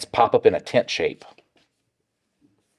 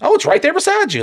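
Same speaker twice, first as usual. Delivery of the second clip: very high, sugary mock-helpful voice; mocking sweet voice; 4 dB louder than usual. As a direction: play it up with a sickly sweet high tone. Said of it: "And you're like".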